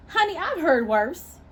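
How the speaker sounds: slow, high, mocking drawl on honey; sassy put-down, slow and sweet; sassy retort. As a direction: brush off the insult with sass.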